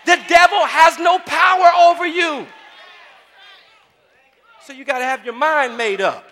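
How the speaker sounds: loud, high impassioned sermon; preaching at full force. Acted. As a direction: build to a shouted preacher's climax. Preaching climax.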